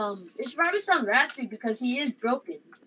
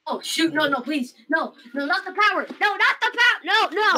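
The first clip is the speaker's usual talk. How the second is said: panicked pleading; 6 semitones higher than usual. Acted. Character losing its power.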